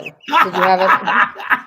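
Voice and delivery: breaking into a laugh, slowing down; real laugh interrupting a repeated question; laughing mid-question. Real, not acted. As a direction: let the question dissolve into laughter.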